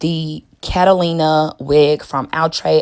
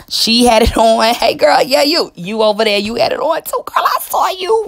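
high, loud mock call across a room; single someone out, voice pitched way up; excited pretend shout to a friend; playful calling out; 7 semitones higher, 6 dB louder than usual.

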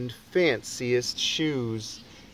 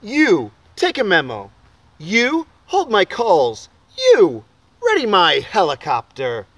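bossy orders; 3 semitones higher, 7 dB louder than usual. Acted. Boy bosses office workers.

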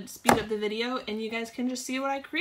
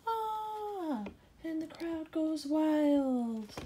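crowd cheer; 6 semitones higher, much slower than usual. Acted.

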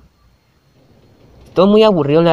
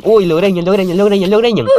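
excited yelling; 6 semitones higher than usual. Real, not acted. Watching Steve and Alex get attacked, excited yelling. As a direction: call it out as they get jumped.

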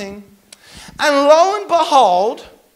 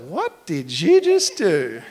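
slow, drawn-out "What did you just do?" with a laugh; a lot of shock; 7 semitones lower than usual. Retold or imitated. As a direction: play up the shock, slowly and laughing. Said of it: "there was a lot of".

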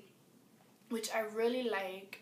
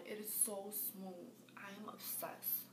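whispered, breathless obsession; 11 dB quieter than usual. Real, not acted.

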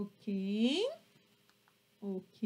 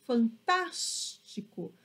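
fantástico with TÁS stretched and strong; fan-TÁS-tico, stressed syllable drawn out; 3 semitones lower, much slower than usual. Shown as a demonstration. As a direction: lean hard on the TÁS of fantástico. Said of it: "ele fica mais forte no tas".

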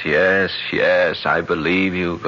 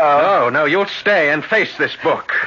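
firm and louder, pressing him; forceful demand; 8 semitones higher, 4 dB louder, slower than usual. Acted. John holding the Bible to the count, forceful demand. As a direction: insist he face the book.

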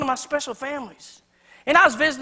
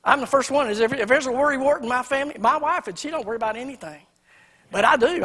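usual speaking voice

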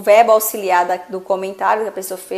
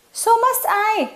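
high quick reply; 11 semitones higher than usual. Shown as a demonstration.

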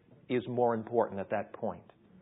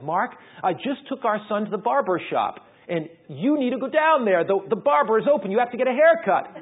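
higher, louder, pushing hard; a wife who won't take no; insistent wife; 8 semitones higher, 9 dB louder than usual. Retold or imitated. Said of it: "she said".

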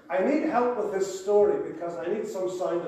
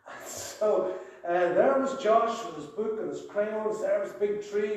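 ordinary talk, nothing marked

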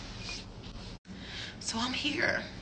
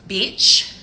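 ordinary talk

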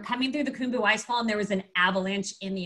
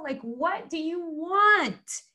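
high, drawn-out exasperated mock shout; retold yell; 7 semitones higher, much slower than usual. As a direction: act out yelling back, stretching each word. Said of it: "we're yelling".

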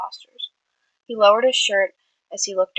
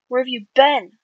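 raised, urgent worried question; worried demand; 5 semitones higher, 6 dB louder than usual. A narrator's reading. Friend bursts out at injured Tommy, worried and loud. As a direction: demand an answer, scared for him. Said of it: "Tubbo demanded worriedly".

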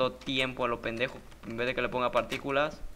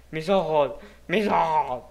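mock agony; 3 semitones higher, 6 dB louder, slower than usual. Mock pain at ugly build.

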